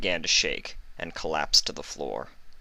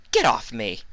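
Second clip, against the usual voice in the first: spat; 5 semitones higher, 4 dB louder than usual. A narrator's reading. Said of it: "Trixie spat".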